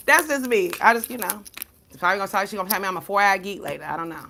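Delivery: ordinary talk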